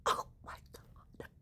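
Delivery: breathy whispered disbelief; shocked whisper. Real, not acted. Vlogger whispering in shock at the story. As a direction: whisper it stunned.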